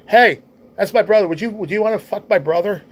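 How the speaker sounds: loud, high retold outburst; imitating a brother's brazen call; brash brotherly announcement. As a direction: blurt it loudly to embarrass him. Brother loudly embarrasses him to her.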